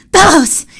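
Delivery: high, loud call; bouncing with excitement; excited cry. Acted. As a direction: burst in calling out. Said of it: "he cried".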